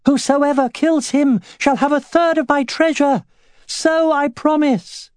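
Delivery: high-pitched, panicky proclamation; scared ruler straining to sound lordly; frightened lord. A narrator's reading. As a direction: proclaim it in fear, pitched far up. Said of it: "sounding more frightened than lordly".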